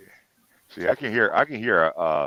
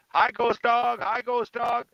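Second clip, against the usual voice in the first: high, raised mock crowd call; imitated chat yelling; 12 semitones higher, 3 dB louder, much slower than usual. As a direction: call out like eager chatters hailing someone. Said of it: "are all yelling out".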